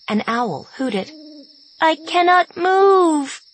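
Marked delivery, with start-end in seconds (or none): 1.8-3.4 high, slow drawn-out calf cry; calf bawl. Acted. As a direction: stretch it like a hurt calf. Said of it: "The calf's voice continued crying out".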